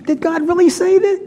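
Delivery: high mocking serpent voice; mocking tempter. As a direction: scoff at it as the devil would. Serpent's taunt peaks.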